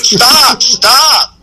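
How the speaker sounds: high shout of stop, breaking into a laugh; retold yell. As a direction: call out stop while waving arms in the water. Waist deep, waving arms, yelling.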